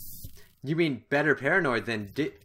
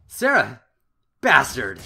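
loud cry of name then curse; anguished shout; 11 dB louder, slower than usual. Acted. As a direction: scream it in rage. Sarah is shot, cries out.

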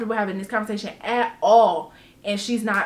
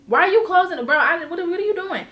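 startled protest; 6 semitones higher, faster than usual. Retold or imitated. Retelling startled objection.

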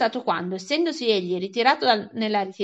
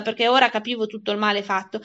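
plain reading voice

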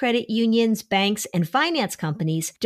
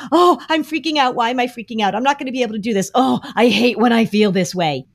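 higher, a bit louder hammed-up freaking out; mock panic; 4 semitones higher, 4 dB louder, faster than usual. Parent modeling the wrong, panicky language, hammed-up panic. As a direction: ham up a parent spiraling into panic. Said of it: "Instead of saying like".

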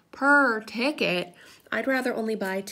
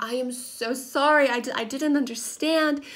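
higher, stammering retold apology; embarrassed apology; 6 semitones higher, 4 dB louder than usual. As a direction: act out a flustered, apologetic tourist. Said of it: "I was embarrassed".

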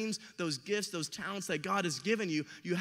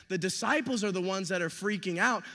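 usual speaking voice

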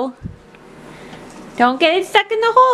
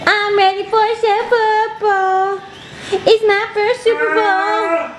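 put-on slow, higher-pitched baby talk; voicing the baby; 4 semitones higher, slower than usual. Mother speaks for baby before her first Super Bowl, slow high baby talk.

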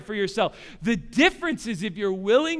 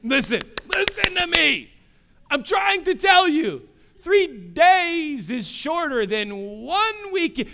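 higher, louder pleading; dad straining to reason with crying son, exasperated; 8 semitones higher, 5 dB louder than usual. Retold or imitated. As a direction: insist on being heard, drawing it out. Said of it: "And I was saying".

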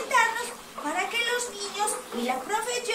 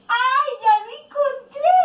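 high, loud playful cry of discovery; excited discovery; 6 semitones higher, 6 dB louder than usual. Pretend. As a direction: call out the find to little children. Finds the lost duck for children. (hallazgo emocionado, agudo y fuerte)

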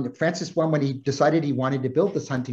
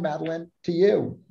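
ordinary delivery

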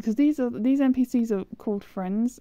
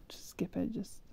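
whispering; 11 dB quieter than usual. Real, not acted. Muttering to herself while playing.

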